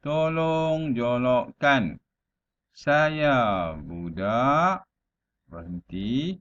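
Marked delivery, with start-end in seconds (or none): 0.0-4.8 "Tolong jolokkan" slow, then a stop before "Saya budak"; unhurried recital, halting after "jolokkan" and "budak"; pauses between phrases. Shown as a demonstration. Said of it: "jangan terus laju".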